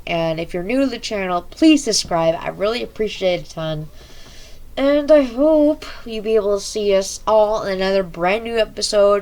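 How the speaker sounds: energetic YouTube sign-off; loud outro. Acted. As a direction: hype up the outro.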